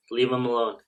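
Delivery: usual speaking voice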